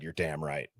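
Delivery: low, soft, creepy agreement. Retold or imitated. Host repeating own remark to the audience, creepy and low. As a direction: sink the voice down low and quiet. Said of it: "a little creepy how I said".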